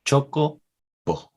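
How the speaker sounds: "Choco" high, a break, then short "bo"; toddler pronunciation. Retold or imitated. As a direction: repeat "Choco bo" as the child splits it. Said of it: "She said".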